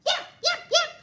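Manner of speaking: very high, sharp yaps; squeaky high yips; little dog yips. Acted. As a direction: yap like a small terrier. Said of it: "said Harry McClary".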